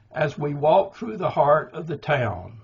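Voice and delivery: walk, heart and town stressed; anapestic trimeter. Shown as a demonstration. Poet reading his Shiraz poem, three beats stressed. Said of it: "now that's anapestic trimeter".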